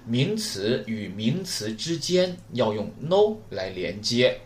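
slow, deliberate repetition of the rule; slow repeated rule for the class; slow emphatic repeat. Shown as a demonstration. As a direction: say it again slowly so students remember. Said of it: "再说一遍".

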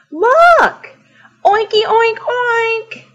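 high-pitched cry and oinks; elated little pig voice; excited piggy cry. Acted. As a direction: call out and oink with glee. Pig spots friends and oinks.

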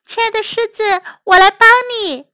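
high mouse voice, earnest; eager little mouse. Acted. Mouse offers the trapped lion help, eager.

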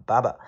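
baba, British barber without any r; British pronunciation. Shown as a demonstration. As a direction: drop both r's, baba, British style. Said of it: "英式发音读baba".

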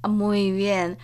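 usual speaking voice

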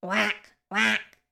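short, abrupt imitated quacks; duck quack. A narrator's reading. Duck calls to the spider with short quacks. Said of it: "called the duck".

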